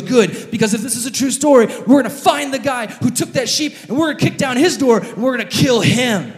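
loud, fast, high threat; vengeful rage. Acted. David the king swearing revenge on the thief. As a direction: vow to hunt the man down. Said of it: "And David goes".

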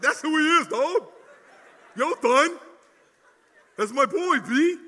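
loud, high, laughing hype-man shout; hyped buddies. Retold or imitated. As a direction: cheer him like your boy just scored. Pastor mimics hyped young guys.

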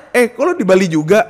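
loud laughing replay of the greeting. Retold or imitated. Replaying what he asked her, loud and laughing.

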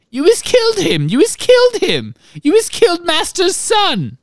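very high, loud house-elf wail; piercing shriek. A narrator's reading. Winky accuses them of killing master's son, piercing shriek. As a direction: shriek the accusation at the top of your range. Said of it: "let out a piercing shriek".

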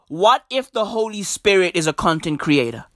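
ordinary talk

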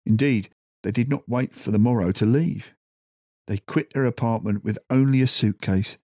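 ordinary delivery, nothing marked

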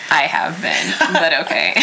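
words broken by real laughter; laughing. Real, not acted. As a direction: laugh through it, a bit embarrassed. Laughing at own misunderstanding.

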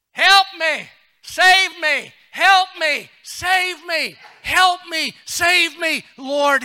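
loud, high, repeated cries for rescue; desperate shout. Acted. Peter sinking, shouting to the Lord for help, desperate. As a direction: cry out like a drowning man. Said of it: "he shouted".

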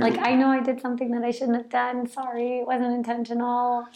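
high, sheepish singsong; singsong your way back into favor; playful. Shown as a demonstration. Partner admitting a small unintended wrong, playful. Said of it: "we add that play into it".

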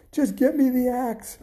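high-pitched, frantic order; urgent demand. A narrator's reading. Boy to his mom as giant climbs down, frantic. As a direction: demand it with no time to spare.

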